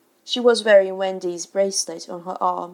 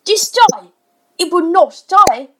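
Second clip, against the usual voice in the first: high, loud exasperated outburst; furious father at his wits' end; yelling; 9 semitones higher, 10 dB louder than usual. A narrator's reading. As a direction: really yell it in frustration. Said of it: "He really yelled".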